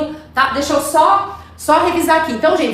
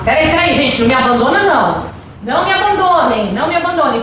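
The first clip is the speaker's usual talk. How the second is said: playful pleading; 2 semitones higher than usual. Pretend. Teacher begs students not to leave, playful pleading. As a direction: beg them in mock desperation to stay.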